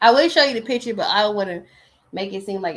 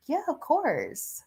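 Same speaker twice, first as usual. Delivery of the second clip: high, drawn-out casual yes; eager agreement; 7 semitones higher, 4 dB quieter, much slower than usual. Retold or imitated. Accepting a friend's invite to the sound bath, eagerly. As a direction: stretch out a high, easy acceptance. Retelling her reply to invitation.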